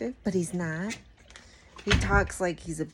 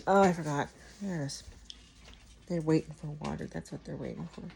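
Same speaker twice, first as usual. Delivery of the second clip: normal talking voice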